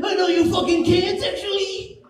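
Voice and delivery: high, snapping mock rage; road rage retort. Comic playing himself in road rage, high mock snap. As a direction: bite back at the driver. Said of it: "Someone's like".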